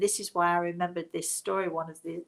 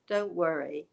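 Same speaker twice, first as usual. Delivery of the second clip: ordinary talk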